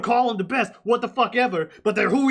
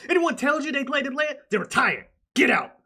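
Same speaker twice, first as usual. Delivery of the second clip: Herm Edwards rant; 7 semitones higher than usual. Retold or imitated. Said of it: "Herm Edwards".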